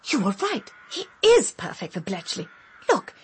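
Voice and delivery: ordinary delivery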